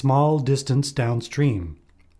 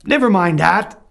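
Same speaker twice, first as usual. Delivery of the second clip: louder, higher wolf snapping back; 5 semitones higher, 6 dB louder than usual. A narrator's reading. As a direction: brush it off as the wolf, loud. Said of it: "said the wolf".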